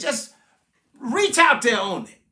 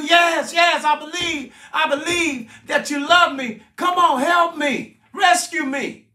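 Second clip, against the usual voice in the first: high, loud repeated pleas; desperate pleading; 6 semitones higher, 5 dB louder than usual. Acted. Hanging man begging his rescuer for help, frantic loud pleading. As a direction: cry out insistently to be rescued.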